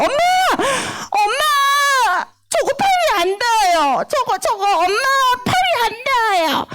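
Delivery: put-on high-pitched whiny child calling for help; imitated young daughter. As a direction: mimic a little girl wailing for mom. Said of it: "우리 딸 요즘에 자주 이래요".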